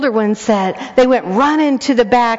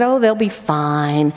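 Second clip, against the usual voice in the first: dismissive husband; 6 semitones lower than usual. Retold or imitated. Husband dismissing her worry.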